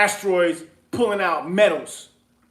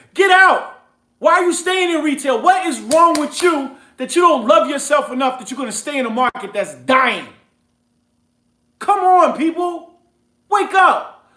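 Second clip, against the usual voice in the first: loud and higher, pushing hard at the crowd; heated yelling rant; 5 semitones higher, 5 dB louder than usual. Real, not acted. Host yelling at his listeners to quit retail. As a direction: preach it at full force, fed up. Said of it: "I ain't trying to yell at y'all".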